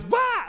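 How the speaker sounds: sudden high yelp of fright; startled yelp. Retold or imitated. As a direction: jump out like a scared bystander. Angel appearance scares everyone.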